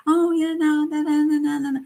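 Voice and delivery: high, sweet mock girlish voice; childlike. Imitating the sweet tenant to viewers, childlike mock voice. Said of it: "She was always childlike".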